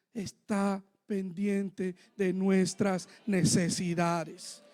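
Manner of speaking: low, soft and very slow; measured, quiet words with weight on each; hushed solemn assurance. Real, not acted. Preacher drawing the moral from his story, hushed and solemn.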